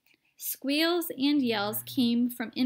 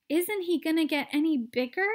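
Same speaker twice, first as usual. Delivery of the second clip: shouted; 5 semitones higher, 4 dB louder than usual. A narrator's reading. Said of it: "shouted Mister T V".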